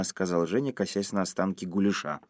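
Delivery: plain reading voice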